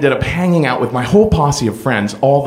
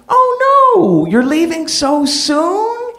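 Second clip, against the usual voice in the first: soaring falsetto protest, hammed-up; camp high dismay; 14 semitones higher, slower than usual. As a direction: squeal it like a flamboyant friend begging you to stay. [name]'s dismayed party goodbye.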